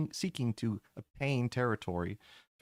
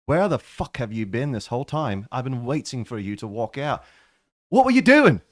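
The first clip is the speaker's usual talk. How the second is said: angry demand; 8 dB louder than usual. Acted. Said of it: "Melvin turns to you and says".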